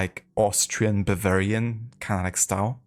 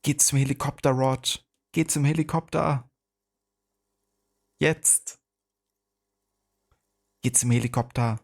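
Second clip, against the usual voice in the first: lightly put-on Arnie-style German, pitched higher; 6 semitones higher than usual. Streamer tries a requested action-film line for chat, light Arnie impression in German. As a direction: have a go at a raised-voice action-hero line in German. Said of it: "try my best to do it in German".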